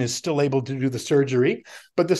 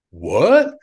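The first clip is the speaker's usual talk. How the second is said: mock baffled; 8 dB louder than usual. Imitating baffled ex-military men.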